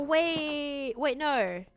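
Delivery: ordinary delivery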